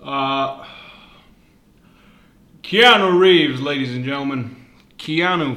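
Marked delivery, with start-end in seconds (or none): 2.6-3.6 loud, high showman call of the name; hyped announcement. Acted. Podcaster hyping the news to listeners, loud showman call. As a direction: announce the star like a ring announcer.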